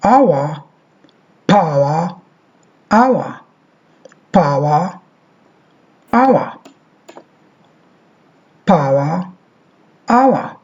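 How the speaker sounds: power as pawa, rising; model word drill. Shown as a demonstration. Teacher drills the diphthong in power. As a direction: repeat our and power. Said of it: "this is diphthong".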